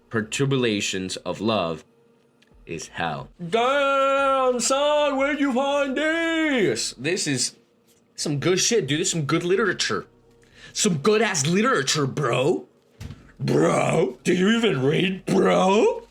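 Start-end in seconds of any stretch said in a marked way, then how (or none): 3.5-6.9 squeaky, very high and slow stunned dude voice; impressed bro. Acted. Reacting to the book as a stunned bro, squeaky high voice. 7.0-16.0 bro voice. Acted. Praising the book to a friend as a hyped bro.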